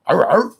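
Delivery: loud, high imitation dog bark; playful dog bark. Streamer greets Maine dog in chat, playful barking.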